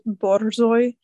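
usual speaking voice

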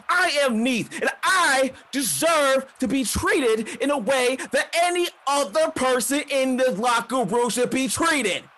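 high-pitched, shouted rant; furious wrestling promo. Acted. Neith rants to the crowd about how she deserves treatment, furious shouting. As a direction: proclaim it at the top of your voice, enraged. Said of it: "they can tell that she's pissed".

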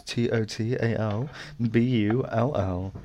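slow spelling. Real, not acted. Spelling the handle slowly.

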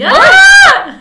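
sudden high shriek of shock; shocked yelp at the screen; real shriek. Real, not acted. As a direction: let out a loud startled scream. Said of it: "Porque yo acá grité".

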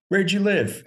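mock-excited, high question; eager question. Rental clerk eagerly quizzing a fellow Tar Heel, high mock excitement. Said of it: "he goes".

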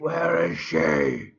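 very low, dragged-out growl; Batman. Retold or imitated. Repeating the film line for laughs, deep slow Batman growl. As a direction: growl it deep, pulling every word long. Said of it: "If you could see your face when he did that".

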